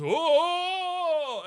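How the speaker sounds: long, high, loud mock wail; cartoon yell of someone plummeting; falling cry. Santa falling off the building, drawn-out mock cry. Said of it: "And then Santa goes".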